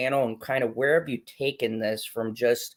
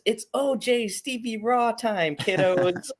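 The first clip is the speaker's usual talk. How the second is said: reading a chat comment in a voice; 9 semitones higher than usual. Retold or imitated. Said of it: "Geekocidal Tendency says".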